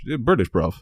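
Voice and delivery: playful put-on British accent; mock British accent. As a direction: answer like a pretend Brit. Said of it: "a British accent".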